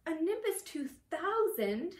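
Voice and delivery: high, slow, loud envious awe; stretched-out, high-pitched jealous amazement; envious moan. A narrator's reading. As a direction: moan it with envy, drawn out. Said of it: "Ron moaned enviously".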